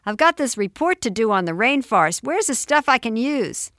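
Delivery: put-on loud twelve-year-old calling to Mom; bellowing boy. A narrator's reading. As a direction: call downstairs demanding help. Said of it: "bellowed Daryl, age twelve".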